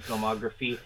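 ordinary talk

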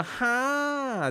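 a long drawn-out high "ha", stretched slowly; mock loud ha. Trainer imitating the client's surprised exclamation, a high drawn-out ha. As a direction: blurt out a high, slow, surprised "ha". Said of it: "大声的哈了一声".

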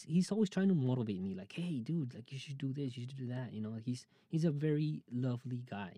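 ordinary delivery

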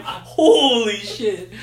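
stretched chuckling wow; laughing awe. Retold or imitated. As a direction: marvel at the warp-speed jump. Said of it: "I was just like".

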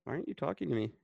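ordinary delivery

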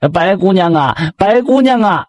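high, drawn-out call up the mountain; acted hailing from afar, vowels stretched. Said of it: "菊花就喊了".

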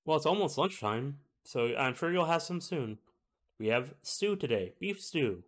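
high-voiced cook talking up lunch; cook character voice. Cook answers about lunch.

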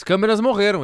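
loud, clear repeat of the question. Shown as a demonstration. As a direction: say it out loud for all. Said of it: "repeats it louder".

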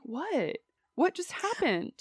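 soft, high, puzzled imitation; mild bafflement. Imitating a co-watcher who missed it, mildly baffled.

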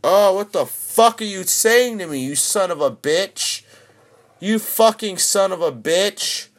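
high-pitched, incredulous outrage; outraged cursing. Real, not acted. Streamer cursing at Disney Plus for not loading, outraged. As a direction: swear at the screen, pitch shooting up.

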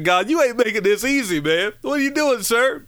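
high-pitched, exasperated plea to God; mock protest. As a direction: protest like you can't believe what God is asking.

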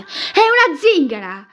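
slow, high shout. A narrator's reading. Aunt objects to the nephew's beloved, shouting. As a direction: cry it out loudly, drawing the words out. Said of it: "gridò lei".

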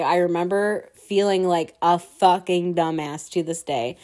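usual speaking voice

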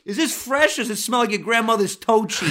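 high, needling wiseguy voice; Italian-American deli customer. Acted. Customer grilling Tony on freshness, high and pushy.

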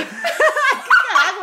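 dramatic voice broken by laughter; laughing. Retold or imitated. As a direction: try the dramatic voice, crack up. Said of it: "nem consigo fazer a voz dramática".

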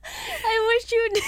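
high, slow fake wail; mock crying. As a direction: whimper it like a hammy sob. Wistful joking reply.

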